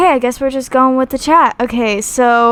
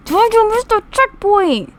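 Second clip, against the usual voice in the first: high, loud exasperated yell; frustrated shout; 11 semitones higher, 5 dB louder than usual. Real, not acted. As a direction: shout at yourself for messing up. Frustrated at missing checkpoint in game.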